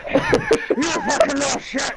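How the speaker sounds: very high, slow, wheezy squeal through a laugh; breathy wheezing fat man. Pretend. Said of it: "He's gonna have the breathing problem".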